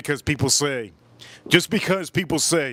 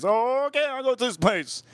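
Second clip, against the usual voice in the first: naive; 7 semitones higher than usual. Retold or imitated. Retold own naive reply.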